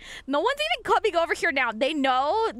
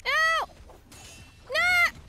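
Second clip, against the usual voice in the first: high cry; 13 semitones higher than usual. Real, not acted. Repeated high cries.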